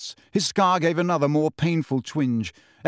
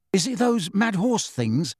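higher, hesitant question; uncertain; 6 semitones higher than usual. A narrator's reading. Ron unable to see the Thestrals, uncertain. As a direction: ask unsure of what is there. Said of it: "said Ron uncertainly".

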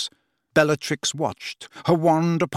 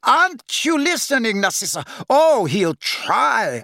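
triumphant mocking; 9 semitones higher, 4 dB louder than usual. A narrator's reading. Bellatrix mocking Snape's promise, gloating. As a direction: gloat over the sister's hopes. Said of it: "cackle of triumphant laughter".

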